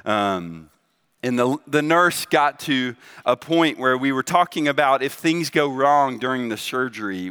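normal talking voice

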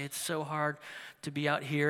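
worn-out survivalist, low and soft; tired Bear Grylls. Retold or imitated. Survivalist confiding to his night camera, tired. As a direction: say it quietly, drained from a day outdoors. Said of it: "he'd be all tired".

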